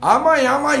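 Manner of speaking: 1 mock cocky taunt. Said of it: "とか言うて".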